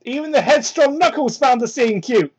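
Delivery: ordinary delivery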